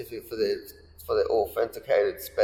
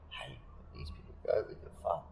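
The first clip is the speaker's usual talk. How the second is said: much softer, breathy hushed whisper; 18 dB quieter than usual. Retold or imitated. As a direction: whisper so the people nearby won't hear. Girlfriend whispering near passers-by.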